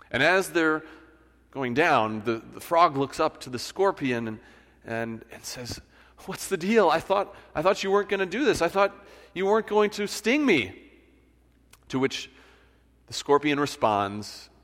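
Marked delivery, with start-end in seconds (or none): 6.3-10.8 high, quick cry of betrayal; a stung frog's shocked protest; betrayed disbelief. Acted. Frog to scorpion as they sink, high and betrayed.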